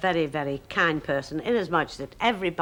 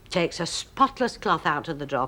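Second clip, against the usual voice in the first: ordinary talk, nothing marked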